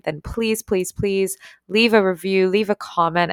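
normal talking voice

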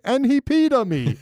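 high whiny tattletale; tattling robot. Retold or imitated. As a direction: squeal it like a complaining kid. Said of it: "he's like".

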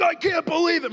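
high, whiny mock of an upset brother; sulky brother's high complaint; mad brother imitation. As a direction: squeal it like a jealous younger brother. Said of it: "oh, he was mad".

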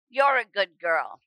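a kindly deaf priest patting her hand, gentle and low; gentle old priest. Retold or imitated.

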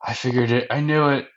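high, loud tearful lament, acted; mock crying. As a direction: imitate someone wailing in disappointment. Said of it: "And Gaimon starts crying".

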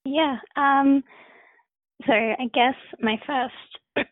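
hoarse, fading voice; rough, strained voice; losing voice. Real, not acted. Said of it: "I'm losing my voice".

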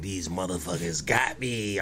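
low, quiet, drawn-out retelling; slow down and drop low, like confiding to a live stream; hushed live-stream report. Retold or imitated. Man retelling his arrest live stream, hushed.

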